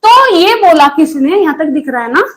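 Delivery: ordinary delivery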